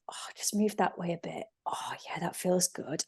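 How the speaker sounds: soft unvoiced whisper of an inner guide; gentle inner whisper. Inner voice whispering kindly to herself on the mat. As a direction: coax the body along in a whisper. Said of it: "that same voice on your mat that goes".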